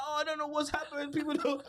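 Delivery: high, whiny mock distress; a hammed-up teary girl after being refused; mock upset girl. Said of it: "She's like".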